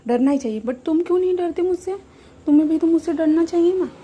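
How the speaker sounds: high, loud mock-menacing tease; playful drunk demand. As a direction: tease him like a tipsy kid. Drunk Wing teasing Wang.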